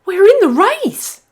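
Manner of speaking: very high, loud joyful cry; cried out. Acted. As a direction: cry it out in triumph. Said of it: "cried Little Nell".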